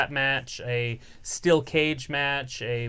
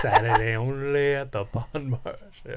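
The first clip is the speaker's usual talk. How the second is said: department store ad; 2 semitones lower than usual. Retold or imitated. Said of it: "I always like the Bon Marché ones".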